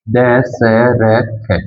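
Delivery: Dasharath spoken very slowly, each syllable held long; drawn out. Shown as a demonstration. Teacher dictating a name to students, slowly drawn out. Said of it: "मैं लिखता हूं".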